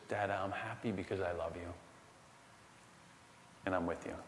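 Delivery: put-on hushed, tender little girl; soft child voice. Daughter tells her dad she loves him, hushed. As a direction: say it softly like a small child. Voicing three-year-old daughter.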